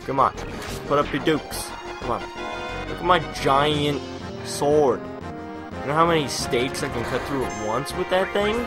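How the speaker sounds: usual speaking voice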